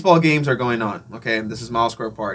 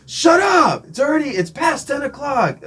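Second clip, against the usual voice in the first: high-pitched imitation of a yelling heckler; retold complaint hollered from afar; mock angry shout; 14 semitones higher, 3 dB louder than usual. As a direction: shout the first words, then keep it high. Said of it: "he's just shouting".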